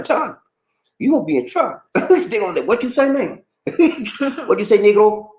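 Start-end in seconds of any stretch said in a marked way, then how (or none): 2.7-3.4 hammed-up challenge, high and laughing; playful confrontation voice; mock local. Locals confronting him, acted. 4.5-5.3 high, louder playful challenge; teasing street call; mock local. Locals confronting him, acted.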